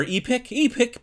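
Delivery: high, loud Irish attempt; Irish accent. Shown as a demonstration. Co-host guesses at pronouncing the name, mock Irish accent. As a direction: try the word in a put-on Irish accent. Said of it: "If I were doing an Irish accent".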